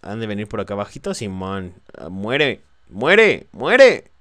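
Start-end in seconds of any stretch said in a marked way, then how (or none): 2.0-4.1 rising loud shout; shouting at enemy. Real, not acted. Gamer yells at an enemy while shooting, louder each time. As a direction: yell it at the enemy.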